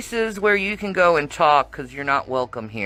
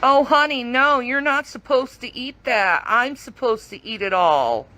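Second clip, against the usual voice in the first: mocking sweet voice; 8 semitones higher than usual. Mocking the greedy woman.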